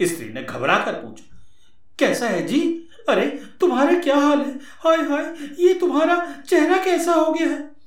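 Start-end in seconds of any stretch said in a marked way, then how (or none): 2.0-7.7 high, fast woman's voice, panicked; alarmed wife voice. Acted. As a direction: gasp it out as a frantic wife.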